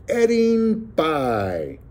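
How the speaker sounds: high, very drawn-out declamation; loud Shakespearean reading. A narrator's reading. Joaquin pretending to read the cookbook aloud, grandly declaimed. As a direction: intone it like a Shakespearean actor. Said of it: "He read it in a loud voice".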